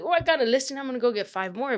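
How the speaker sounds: imitating her past cocky self; excited boast. Retelling her rookie self bragging after a first listing, eager.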